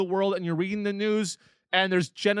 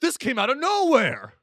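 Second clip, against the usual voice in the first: mock clueless victim; 7 semitones higher than usual. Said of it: "And they go".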